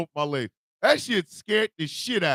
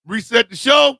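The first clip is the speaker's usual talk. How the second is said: announcer shout; 6 semitones higher, 8 dB louder than usual. Real, not acted. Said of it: "call-out before intro".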